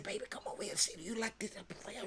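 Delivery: hushed, secretive recruiter whisper; coaxing whisper. Pretend. Whispering to a tall girl, coaxing her to volleyball. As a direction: lean in and whisper the pitch to her.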